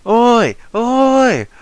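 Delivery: high shouted oi; calling out. Acted. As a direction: yell it across a distance. Calling out to daughter.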